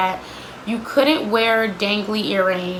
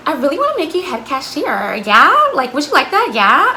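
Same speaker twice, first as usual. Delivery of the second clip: put-on higher, sing-song baby talk with loud rising yeahs; baby-talking boss; 5 semitones higher, 4 dB louder than usual. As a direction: coo at her like she's a toddler. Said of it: "she always talked to you really condescending".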